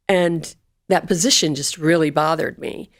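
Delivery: usual speaking voice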